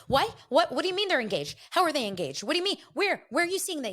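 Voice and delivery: high, loud rapid-fire what questions; shocked disbelief. Retold or imitated. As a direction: freak out in total disbelief. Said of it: "the way that I reacted".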